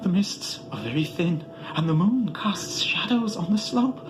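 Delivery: high, ecstatic voice of Barzai climbing, dropping to a hush at the end; shriller, rising sage. A narrator's reading. Barzai calls down to Atal about the moonlit gods, shrill and rapt. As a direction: rapt and breathless, let it climb. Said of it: "heard Barzai's voice grow shriller".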